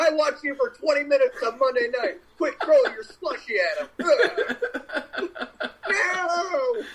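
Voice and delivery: high, hammed-up taunting; mock heckler. Acts out heckler throwing slushy.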